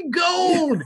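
put-on high, crazed old prospector. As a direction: gasp out the word like a mad miner. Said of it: "It's that guy".

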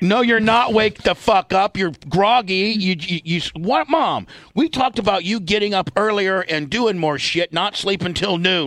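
high, intense ranting callout; wrestling promo. Acted. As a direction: rant at her like a wrestler on the mic. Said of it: "cut a promo on her".

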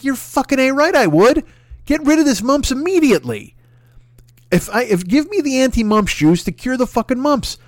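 high, keen and insistent; eager yes. Retold or imitated. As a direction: jump at the offer. Eager acceptance quoted.

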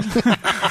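short amused laugh. Real, not acted. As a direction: laugh at your own joke. Laughs at own joke.

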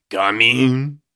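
loud, cartoonish French accent; over-the-top comic French accent; exaggerated French accent. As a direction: sing out in a hammed-up French accent, rising. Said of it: "give him a French accent".

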